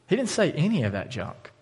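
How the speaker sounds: normal talking voice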